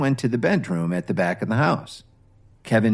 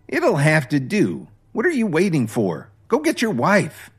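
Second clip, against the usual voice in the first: scoffing mother-in-law; 7 semitones higher than usual. Acted. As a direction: sneer it down at a lazy son-in-law. Dorothy orders Kevin around.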